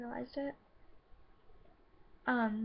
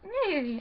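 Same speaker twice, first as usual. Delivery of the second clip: high, loud nasal "hmm" imitating classmates; mock horse hum; 6 semitones higher, 6 dB louder than usual. Classmates mocking her under their breath, loud high hum.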